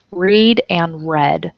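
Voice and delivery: "Read" as reed, then "read" as red; slow, reed then red; two readings. Shown as a demonstration. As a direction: slowly show the two sounds. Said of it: "spelled the same way".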